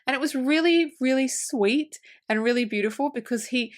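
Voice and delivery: usual speaking voice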